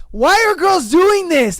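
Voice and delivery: high, loud, fed-up cry; exasperated shout at the absurdity; exasperated outburst. Real, not acted. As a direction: throw up your hands and yell it out. Exasperated rant about dating tricks.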